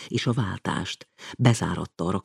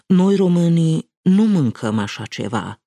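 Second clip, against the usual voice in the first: raised, louder self-assured Romanian; proud declaration; 9 semitones higher, 6 dB louder than usual. A narrator's reading. Official declares proudly to the donors, raised voice. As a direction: state it proudly, loud and high. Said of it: "mondta öntudatosan".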